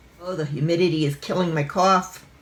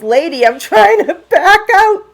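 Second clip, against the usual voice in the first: mock gentleman; 10 semitones higher, 11 dB louder than usual. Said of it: "the gentleman in this car opens his door and says".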